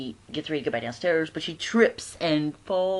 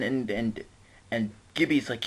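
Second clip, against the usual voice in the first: ordinary talk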